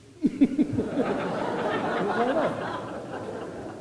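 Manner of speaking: laughter. Real, not acted. Audience laughs at punchline.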